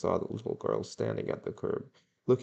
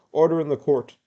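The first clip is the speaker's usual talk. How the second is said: loud, raised court officer's call; calling to order; 7 semitones higher, 10 dB louder than usual. A narrator's reading. Constable cutting off an argument in court, calling out loudly. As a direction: cut in louder and higher to command order.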